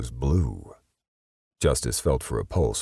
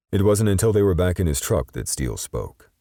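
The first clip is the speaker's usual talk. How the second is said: ordinary delivery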